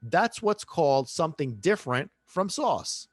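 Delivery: ordinary talk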